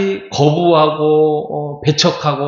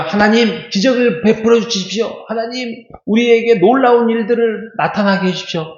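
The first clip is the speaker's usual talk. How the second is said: higher-pitched mock supplication to God; imitated pleading prayer; 8 semitones higher than usual. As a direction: pray aloud like an eager petitioner, pitched up. Acting out people's prayer for miracles.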